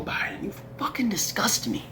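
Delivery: quiet seething contempt at Joe Biden; quiet disgust. Real, not acted. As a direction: spit the words under your breath. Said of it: "I'm sorry for being so angry".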